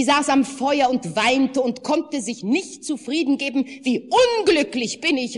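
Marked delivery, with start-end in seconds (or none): 3.7-5.4 high, loud wailing cry; wailing lament. A narrator's reading. The weeping wife laments to her husband, high wail. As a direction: wail it out from the fire. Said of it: "rief sie aus".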